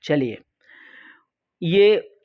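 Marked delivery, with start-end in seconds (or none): none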